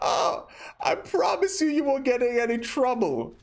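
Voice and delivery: high cackling laugh through the words. Real, not acted. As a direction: lose it laughing mid-sentence. Laughing fit while promising.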